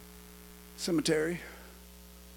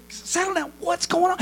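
urgent calming; 14 semitones higher, 5 dB louder than usual. Acted. Grandpa to frantic grandson, urgent calming. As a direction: cut in to settle a frantic child. Grandpa interrupts panting boy.

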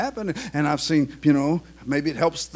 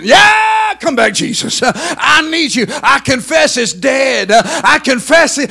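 full-force preaching; 10 semitones higher, 12 dB louder than usual. Acted. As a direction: cry it out to heaven.

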